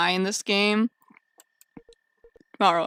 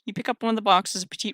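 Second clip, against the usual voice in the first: ordinary talk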